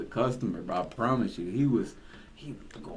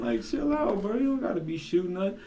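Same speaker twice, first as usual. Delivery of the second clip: retelling his reaction; 10 semitones higher than usual. Retold or imitated. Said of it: "I was like".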